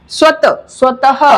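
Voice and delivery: स्वतः ending in a breathed हा, unlike स्वत; plain then visarga; visarga contrast. Shown as a demonstration. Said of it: "उच्चारण होता है वो हा होता है".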